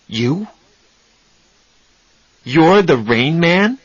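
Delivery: slow, rising, astonished question; stunned disbelief. Acted. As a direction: ask it slowly, hardly believing. Charlie stunned by discovery.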